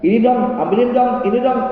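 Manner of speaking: suara lebih tinggi dan keras, menyuruh seenaknya; istri menyuruh. Retold or imitated. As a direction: menuntut dengan nada manja tinggi. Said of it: "tidak pernah di keluarganya diajari begitu". (istri menyuruh suami, nada tinggi manja)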